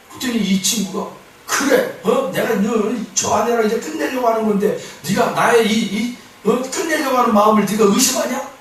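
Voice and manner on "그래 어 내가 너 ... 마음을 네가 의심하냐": mildly indignant, higher-pitched retort; imitated annoyed comeback, raised pitch; retold anger. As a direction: act out an irritated man challenging his friend. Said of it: "또 화를 내는 거예요".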